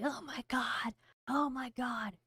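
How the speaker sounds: half-hearted panic, oh my God; mock crash-out freakout. Streamer imitating herself crashing out, mild mock panic. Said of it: "If I were crashing out, I'd be like".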